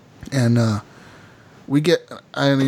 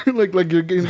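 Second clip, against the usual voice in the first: ordinary delivery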